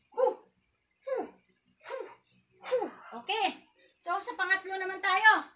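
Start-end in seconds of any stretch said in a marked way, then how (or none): 0.1-2.8 short exhaled hu on each strike; kiai grunts. Shown as a demonstration. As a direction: snap out a hu per punch. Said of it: "Sa pangalawa". (matinding hu sa bawat suntok)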